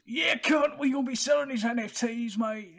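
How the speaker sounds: loud, high cheeky Australian bloke; mock Aussie accent. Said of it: "Is Ubisoft an Australian company?".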